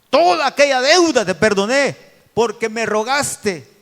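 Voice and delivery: preacher voicing a furious king; angry king's rebuke. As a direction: scold him from the throne, loud. King rebukes the wicked servant.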